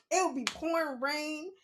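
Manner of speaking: ordinary talk, nothing marked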